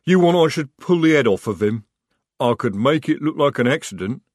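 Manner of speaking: loud, blunt troll; loud troll offering help. A narrator's reading.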